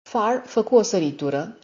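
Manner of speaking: plain reading voice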